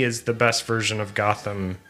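ordinary delivery

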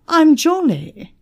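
high, loud self-introduction; cocky man. A narrator's reading. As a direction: announce yourself loudly and proudly. Arrogant man introduces himself to Emma.

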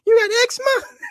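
put-on high squeaky little-kid voice, asking in disbelief; little boy on Vine. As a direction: pitch way up like a tiny toddler teasing. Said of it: "he was like".

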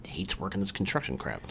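hushed whisper. Real, not acted. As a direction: drop to a breathy whisper. Whispered aside about dad.

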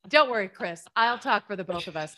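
joking boast. Retold or imitated. Joking to husband about talking.

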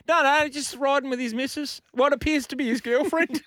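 very high put-on caller voice; squeaky mock informant explaining; tattletale caller. Caller reporting a scooter rider to triple O, put-on tattletale voice. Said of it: "you imagine the phone call".

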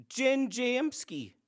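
usual speaking voice

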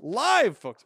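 excited repeat. Acted. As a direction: shout "Live, folks" with a thrill.

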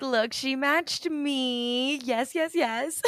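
very slow, drawn-out proud voice; proud Donald. Retold or imitated. Host voicing proud Donald showing off the guest, slow. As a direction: savour each word like a proud duck. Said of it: "Donald's all proud of you".

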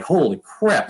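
high-pitched stunned exclamation; retold amazement. Player reacting to making the team, astonished. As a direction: relive the news sinking in, voice lifted. Retelling shocked news from teammate.

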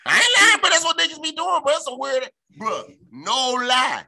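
high, loud emphatic rant; really fired up now; worked up. Real, not acted.

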